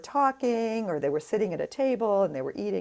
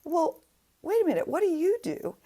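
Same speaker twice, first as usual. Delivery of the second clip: a lightly voiced mezzo, higher and unbothered; calm mezzo; 9 semitones higher than usual. Mezzo calmly answering the drunk guest, lightly voiced. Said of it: "she very calmly says".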